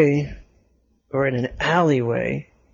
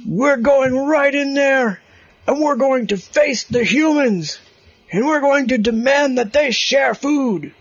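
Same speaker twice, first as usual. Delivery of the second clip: rat leader speech; 11 semitones higher than usual. Acted. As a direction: rouse the crowd from the stage. Rat leader points at building.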